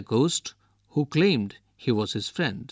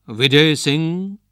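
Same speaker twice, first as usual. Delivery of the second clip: slow ghostly call, pitched higher and louder; louder, higher ghost calling the name again; 4 semitones higher, 7 dB louder, slower than usual. A narrator's reading. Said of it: "he heard a voice".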